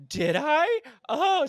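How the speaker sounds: high gasping surprise; mock surprise. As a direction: play innocent shock. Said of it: "she was like".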